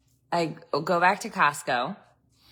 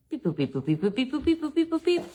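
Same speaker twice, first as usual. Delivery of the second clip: mock typing beeps; 8 semitones higher than usual. Imitating computer typing sounds.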